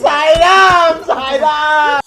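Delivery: hollering for a friend far off; long, loud calls of a name; calling out loud. Acted.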